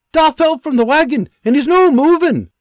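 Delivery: a son faking panic about his da; urgent alarm. Acted. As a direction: sell the lie with panic. Son reports fake accident.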